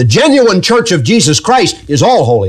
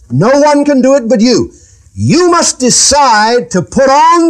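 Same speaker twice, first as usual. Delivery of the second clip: raised pitch, driving preacher cadence; insistent preaching; 8 semitones higher than usual. Real, not acted. Preacher telling congregation nobody can do it for them, insistent. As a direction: hammer each phrase home in a high preaching voice.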